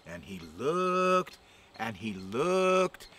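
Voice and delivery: very slow, drawn out; slow suspense. A narrator's reading. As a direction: stretch each word to build suspense. Suspense build.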